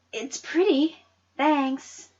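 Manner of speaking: put-on shy girl. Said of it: "I said".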